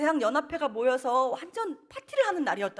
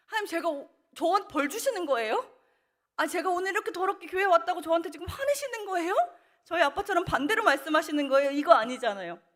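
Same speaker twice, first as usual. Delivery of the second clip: higher, louder voice, protesting through tears; tearful protest; 7 semitones higher, 4 dB louder than usual. Retold or imitated. Young woman protesting to God at church, tearful. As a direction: plead in rising upset, as if wronged.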